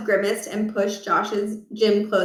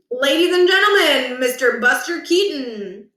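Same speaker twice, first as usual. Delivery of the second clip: mock announcer; 4 semitones higher, 3 dB louder than usual. Coach announces like emcee.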